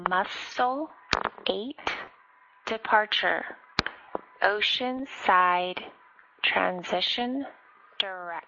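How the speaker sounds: slow, word by word with pauses; slow and deliberate. Real, not acted. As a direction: spell it out slowly for a confused pilot. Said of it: "could you say again heading".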